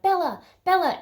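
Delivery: high calling voice; mock mother calling; imitated worried mom. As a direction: call out the name in a high pitch. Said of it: "hears her mom at first going like".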